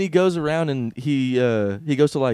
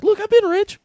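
much higher boastful voice; Gary Vee impression. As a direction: brag it, pitched way up. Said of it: "he's like".

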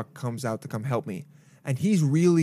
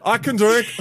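put-on brash drunk grown-up; 9 semitones higher, 7 dB louder than usual. Drunk adult bit.

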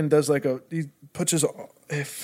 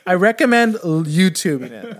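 normal talking voice

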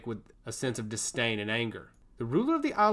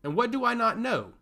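higher, sharp demand; harsh; 10 semitones higher, 3 dB louder than usual. A narrator's reading. Vizier demanding answers in a harsh tone. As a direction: cut through the air with it. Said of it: "the harsh tone of her voice".

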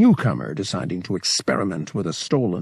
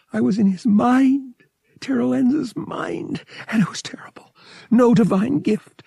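much higher and louder, whispering the name; shaken, the horror spilling out; distraught; 13 semitones higher, 4 dB louder than usual. Acted. Bria pouring out her horror to Vic, high and trembling.

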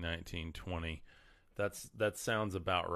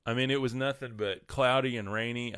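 ordinary delivery

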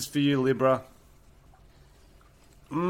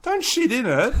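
high, fast ranting outrage; high-pitched rant; 12 semitones higher than usual. Retold or imitated. Ranting incredulous at countries.

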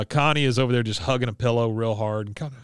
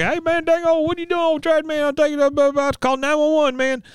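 very high, frantic rambling impression; Boomhauer; 4 dB louder than usual. Chasing the trash truck, imitated frantic high rambling. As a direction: ramble excitedly after a departing truck. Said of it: "Boomhauer runs after the trash truck. He's like".

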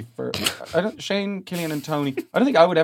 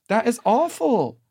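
slow, higher Southern sympathy; Southern lament; 6 semitones higher, slower than usual. Pretend. Host echoing the cohost in a Southern lady voice. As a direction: draw it out like a pitying Southern lady.

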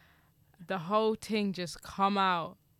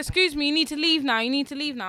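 loud, raised retold order; firm confrontation; 7 semitones higher, 9 dB louder, faster than usual. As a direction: tell them off urgently, higher and louder. Retold confrontation with two men.

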